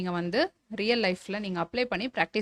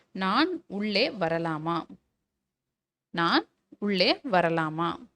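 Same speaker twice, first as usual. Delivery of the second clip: slow dictation; much slower than usual. Shown as a demonstration. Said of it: "teacher dictates a model sentence".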